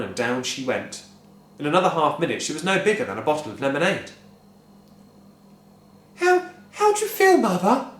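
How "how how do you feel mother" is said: high, stammering worried question; anxious. A narrator's reading. Wife anxiously asking her tiny mother. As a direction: ask nervously. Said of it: "asked Missus Cranky anxiously".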